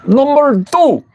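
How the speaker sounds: put-on very high, comic Filipino accent; mock Filipino accent. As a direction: call it out in exaggerated Filipino English. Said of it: "Can you do a Filipino accent".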